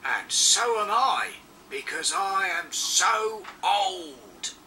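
slow, proud and pleased. Acted. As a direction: boast it slowly with pride. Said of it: "he added proudly".

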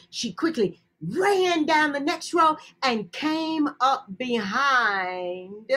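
ordinary delivery

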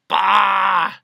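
scoffing outburst. A narrator's reading. Humbug scoffing at proper spelling, loud outburst. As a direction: blurt it out dismissively.

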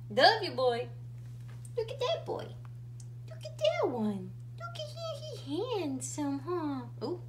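high, loud doting coo. Real, not acted. Scolding then cooing at dog.

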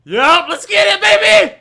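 high, loud victory holler; triumphant shout. Real, not acted. Player back in the game, triumphant shout. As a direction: yell it in celebration. Celebrates a kill.

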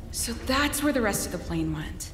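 usual speaking voice

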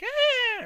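high, loud imitated yelp; hammed-up video game hero yelp; Link scream. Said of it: "I feel like Link at the beginning of Majora's Mask. He's like".

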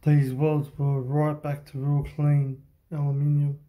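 normal talking voice

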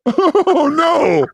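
mock-horrified shout; yelling in disgust. Real, not acted. Reacting in disgust.

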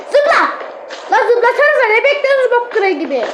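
high urgent yell. Real, not acted. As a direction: yell at them to jump. Yelling at teammates to jump.

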